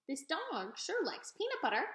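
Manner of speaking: slow, lightly voiced old woman; chuckling old lady, amused; a slow old woman amused by the dog; old woman's voice. A narrator's reading.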